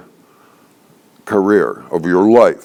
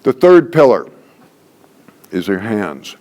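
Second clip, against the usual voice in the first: ordinary talk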